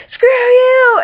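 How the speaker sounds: very high, loud squealed retort; hammed-up furious comeback; mock angry yell. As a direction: yell it back, stretched out. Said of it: "John yelling at Claire, and then Claire's all like".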